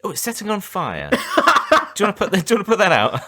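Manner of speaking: high put-on mother voice, laughing; mock mum.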